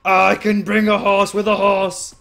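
loud, high, overjoyed shouting; ecstatic yelling. Real, not acted. Player thrilled the horse can follow, ecstatic yelling. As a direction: yell it out in huge excitement.

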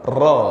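Ra with the mouth wide open; open ra demo. Shown as a demonstration. Teacher demonstrating an open ra. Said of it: "maknanya ra tu kena buka".

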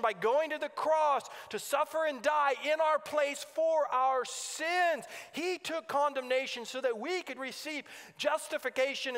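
emphatic, driving gospel proclamation; impassioned preaching. Real, not acted. Preacher proclaiming that Jesus fought for us, impassioned preaching. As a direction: proclaim it with full conviction.

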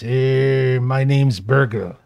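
a hint of a slow, grudging character; slightly put-on voice, drawn-out words; lightly voiced captive. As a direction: introduce yourself slowly, lightly in character. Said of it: "and he says".

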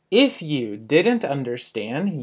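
ordinary talk

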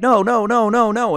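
high, loud string of refusals, retold; urgent protest. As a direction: protest in mock alarm. His retold protest to friends.

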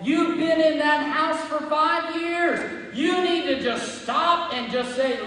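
forceful, driving sermon voice; impassioned preaching. Real, not acted. Preacher telling the congregation praise is okay, impassioned. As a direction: preach it out with fervor.

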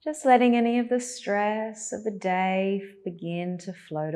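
calm, even cues with long pauses; unhurried, guiding attention to the breath; guided meditation. Acted.

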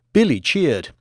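plain reading voice

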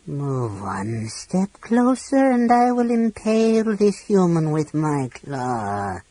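slow, high dragon threat; menacing dragon voice. A narrator's reading. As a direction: threaten slowly, savouring it.

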